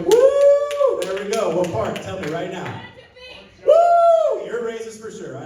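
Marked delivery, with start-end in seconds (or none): 0.0-1.0 high excited whoop. Real, not acted. Comic cheering the crowd with a loud whoop. 3.6-4.4 high excited whoop. Real, not acted. Comic cheering the crowd with a loud whoop.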